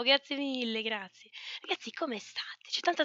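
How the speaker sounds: ordinary talk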